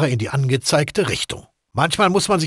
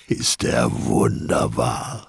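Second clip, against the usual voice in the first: chuckling low through the words; dark chuckle; 3 dB quieter, much slower than usual. Acted. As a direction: laugh it out, savouring the gun. Gangster savouring the revolver's power.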